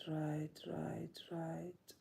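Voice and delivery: hushed, fading musing to oneself; trailing off. Real, not acted. Vlogger musing on a daily writing habit, fading away quietly. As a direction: repeat it softly, fading almost to a whisper.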